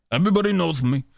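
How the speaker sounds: quick, lightly brash boast; Dave Portnoy impression. Host bragging to his audience, in a quick cocky voice. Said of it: "All right, Dave Portnoy".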